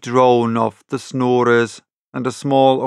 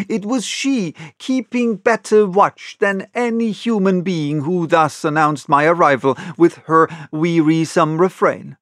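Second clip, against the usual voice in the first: ordinary delivery, nothing marked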